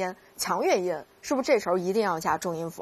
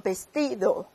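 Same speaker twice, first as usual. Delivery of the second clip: vestido drawn out, stress on ti; slower than usual. Shown as a demonstration. Teacher shows participle rhythm, stress on ti in vestido. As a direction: stretch the ti in vestido slowly. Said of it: "还有过去分词这种节奏吗".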